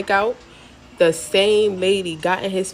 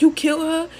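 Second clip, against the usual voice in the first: high-pitched disbelieving question; incredulous, rising high; shocked question; 10 semitones higher, faster than usual. Retold or imitated. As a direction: act out the friend's shock, pitched way up. Friend's shocked question, acted out.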